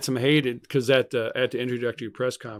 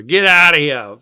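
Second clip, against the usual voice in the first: loud, higher-pitched Boston accent; mock Boston accent; 5 semitones higher, 12 dB louder, slower than usual. Shown as a demonstration. Fan shows how they messed around, in mock Boston accent. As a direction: holler it in a put-on Boston accent. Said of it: "messed around with the Boston accent".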